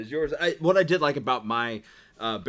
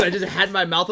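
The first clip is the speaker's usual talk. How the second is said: ordinary talk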